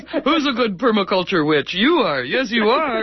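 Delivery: high, sing-song doggy praise through a laugh; baby talk to a beloved dog; talking to a good dog. Pretend. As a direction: coo it like praising a puppy. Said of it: "talk to everything like it's a wonderful dog".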